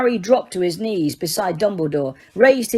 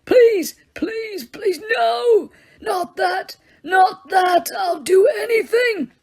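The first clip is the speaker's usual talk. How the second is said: high, crying shouts of please; old man screaming for mercy; 11 semitones higher, slower than usual. Acted. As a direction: scream and beg in terror. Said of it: "screaming again".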